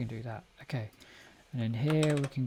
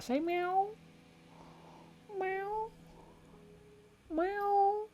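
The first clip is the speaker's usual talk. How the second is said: much higher, sing-song meows to a pet; cat baby talk; 7 dB quieter, much slower than usual. Pretend. Talking to the cat.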